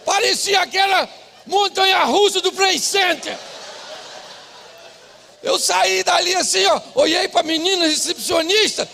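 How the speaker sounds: loud, high, driving preacher voice; revved-up preacher storytelling; full-force preaching. Acted. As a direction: belt it out to the congregation.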